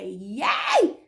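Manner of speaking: short, sharp retold yell; mock attacker's bark; imitated robber's shout. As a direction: startle them with a sudden cry. Robber's sudden shout in retelling.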